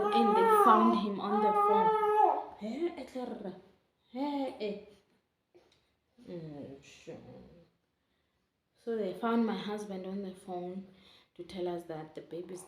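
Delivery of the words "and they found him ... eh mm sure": real crying, much higher and louder; sobbing out the news through tears. Real, not acted. Emotional memory of baby's discharge.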